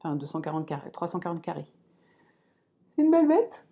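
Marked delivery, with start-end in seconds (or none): 2.9-3.7 high, loud delighted exclaim; amazed. Real, not acted. Vlogger shows viewers her huge cross-stitch pattern, loud and amazed. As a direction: marvel at the size of it.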